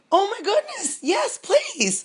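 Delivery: high, delighted gasp and plea; eager yes to hear it; thrilled. Real, not acted. Offered a reading.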